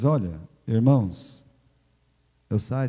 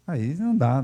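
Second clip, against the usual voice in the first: ordinary talk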